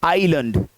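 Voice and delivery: आइलैंड said "eye-lund", weak second vowel; correct pronunciation. Shown as a demonstration. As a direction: reduce the end of आइलैंड to "lund". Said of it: "सही pronunciation क्या है".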